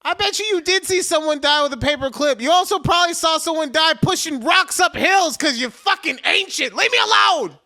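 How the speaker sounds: high, loud shouting building to a yell; mock-furious tirade. Man mocking ancient coworker's warning, hammed-up fury. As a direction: rant in exaggerated outrage. Acted angry rant at coworker.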